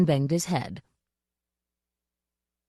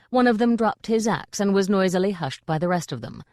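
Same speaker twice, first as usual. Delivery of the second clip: plain reading voice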